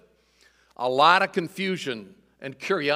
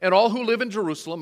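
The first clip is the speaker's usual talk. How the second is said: louder, higher proclamation; raised voice; 5 semitones higher, 8 dB louder than usual. Acted. Peter addressing the crowd in Jerusalem, raising his voice to proclaim. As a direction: call out to the whole crowd. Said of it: "raised his voice".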